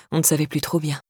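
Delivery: plain reading voice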